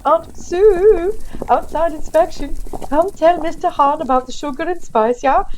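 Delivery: high, loud holler to a relative; cheerful shouted summons; calling out. Acted. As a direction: call across a factory floor, high and loud. Elf calls out to his aunt.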